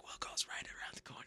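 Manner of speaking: very soft, voiceless whisper; imitated staff whisper. Staff pointing the way to will call, imitated whisper. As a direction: mimic an usher whispering directions. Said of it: "they're all whispering".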